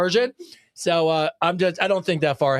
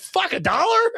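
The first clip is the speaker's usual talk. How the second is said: "Fuck, a dollar?" much higher, incredulous; mock shocked listener; 13 semitones higher than usual. Imitating shocked listeners at the price, pitched much higher. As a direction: squeak out the disbelief. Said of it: "people are like".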